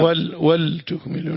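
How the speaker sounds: slow wal-, then ولتكملوا as waltukmilu; vowelless lam. Shown as a demonstration. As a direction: dwell on the closed wal- in ولتكملوا. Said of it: "بإسكان الله".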